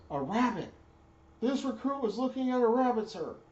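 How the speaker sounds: high, loud recruit reply; recruit shouting back. Retold or imitated. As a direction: sound off like a scared recruit. Recruit answering.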